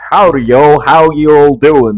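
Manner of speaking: loud, hammed-up bad American accent, slow on the second half. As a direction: overdo a cowboy American greeting, loudly. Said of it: "if he was doing a bad American accent".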